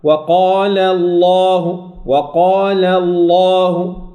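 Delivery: slow, sustained «وقال الله» with a heavy L; lam pesado. Shown as a demonstration. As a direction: hold الله long, dark velarized L and long vowel. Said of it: "أفخم اللام".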